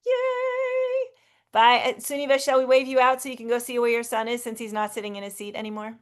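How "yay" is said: high excited yay; cheering. Real, not acted. Cheering goodbye wave.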